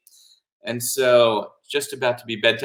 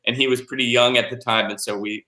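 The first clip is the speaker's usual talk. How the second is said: plain reading voice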